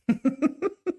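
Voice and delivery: a high, sudden laugh; high burst of laughter. Real, not acted. Streamer laughs about his impression, high laugh.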